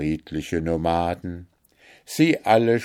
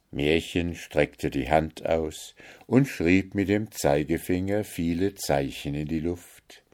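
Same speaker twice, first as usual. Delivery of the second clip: usual speaking voice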